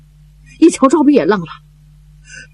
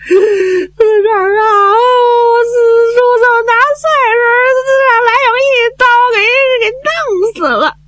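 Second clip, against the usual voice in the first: sobbing out the news. Acted. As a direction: break down as you say he died. Breaks news of uncle's death. (哭着说出四叔被杀)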